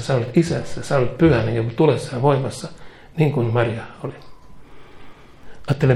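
ordinary talk, nothing marked

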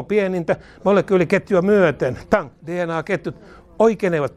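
ordinary talk, nothing marked

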